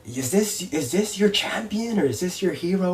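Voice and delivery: imitated heel jeer, pitched high; trash talk. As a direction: play a villain wrestler mocking the crowd's hero. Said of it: "and he's talking trash".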